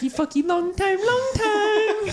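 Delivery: high, loud sing-song mock accent. Friend mocks an accent for a laugh in a high, loud singsong.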